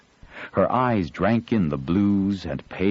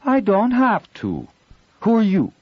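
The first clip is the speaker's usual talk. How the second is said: put-on defiant girl; 6 dB louder than usual. Girl stands her ground.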